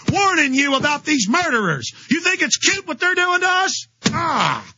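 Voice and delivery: high, indignant telling-off; angry retort. Retold or imitated. Host retelling how he told off the cop, high and indignant. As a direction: blast him like you're chasing off a pest. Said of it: "And I'm like".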